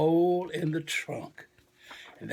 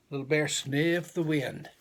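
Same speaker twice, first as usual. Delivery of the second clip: ordinary delivery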